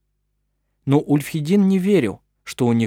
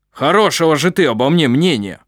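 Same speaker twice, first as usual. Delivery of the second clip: loud, stung retort; angry retort; 3 semitones higher, 6 dB louder than usual. A narrator's reading. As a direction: throw the words back, offended. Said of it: "бросил Ульфхедин".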